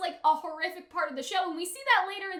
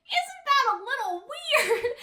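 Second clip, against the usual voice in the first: mock puzzled doll; 8 semitones higher, 4 dB louder than usual. Imagined doll questioning the weirdness.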